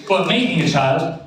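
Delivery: normal talking voice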